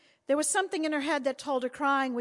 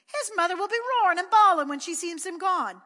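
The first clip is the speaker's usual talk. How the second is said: put-on high, loud fretting child; a small boy warning loudly; high child's alarmed voice; little boy Pat; 8 semitones higher, 11 dB louder than usual. A narrator's reading. Pat warns about the mother.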